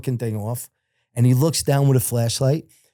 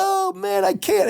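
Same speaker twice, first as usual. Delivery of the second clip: high-pitched mock moan of helplessness; pained whine; slower than usual. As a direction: imitate an injured man wailing, very high. Injured man whining, acted in story.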